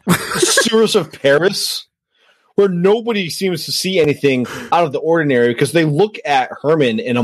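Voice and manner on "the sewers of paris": slow, high disbelieving echo; can't believe what he just heard; incredulous. Real, not acted. Cohost echoing the claim back in slow disbelief.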